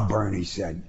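ordinary delivery, nothing marked

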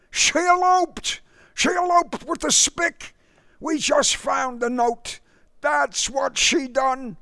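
high, loud enraged wail; furious outburst. Acted. Father rages to the suitor about the elopement.

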